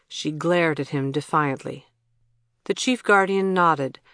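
ordinary delivery

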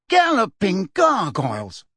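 shocked exclamation. Acted. Fudge leaps back in shock.

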